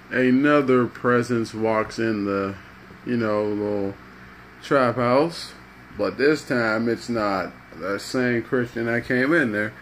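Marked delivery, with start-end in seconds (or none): none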